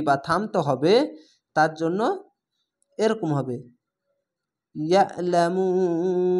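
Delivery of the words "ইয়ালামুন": ইয়ালামুন chanted with the final ন held long; ইয়ালামুন intoned with a long closing ন hum; hold the noon. Shown as a demonstration. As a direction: recite ইয়ালামুন, sustaining the ন. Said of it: "এই নুনকে ধরে নিতে হবে".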